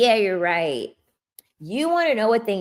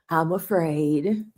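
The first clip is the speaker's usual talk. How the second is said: mock fear; slower than usual.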